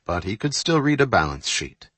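ordinary delivery

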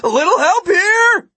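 loud, high shout for help; shouted call. A narrator's reading. Martin shouts to Gwen for help mid-chase, shouted. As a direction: yell out urgently to someone overhead. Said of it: "Martin shouted".

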